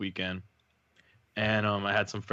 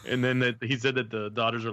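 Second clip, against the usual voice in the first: ordinary talk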